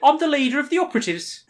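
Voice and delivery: high, fast Brummie, close to laughing; hammed-up Brummie on the edge of giggles; Brummie accent. Dave tells the players his rank, high giggly Brummie accent. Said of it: "I want a proper Brummie".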